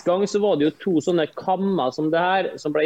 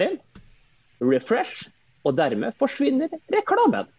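upbeat infomercial pitch, higher; ad read; 4 semitones higher than usual. Acted. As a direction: sell it like a TV commercial. Dramatized lifehack ad read.

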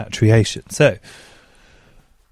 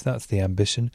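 ordinary talk

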